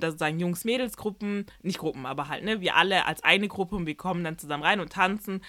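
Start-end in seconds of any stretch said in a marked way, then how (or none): none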